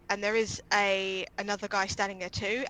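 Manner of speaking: normal talking voice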